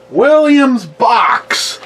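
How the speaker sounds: very slow, high showy name; drawn-out announcer. Pretend. Vlogger naming his next drink, mock announcer. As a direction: stretch the name out like a host.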